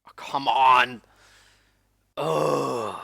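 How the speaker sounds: loud frustrated groan and grunt; effort grunt. Real, not acted. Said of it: "Sorry for my grunting sounds".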